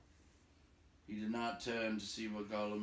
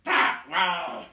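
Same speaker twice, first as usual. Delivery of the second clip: higher, far louder goblin shout; goblin yell; 9 semitones higher, 16 dB louder than usual. A narrator's reading. Other goblins pointing the other way, yelling. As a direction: yell it back like another goblin. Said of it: "others yelled".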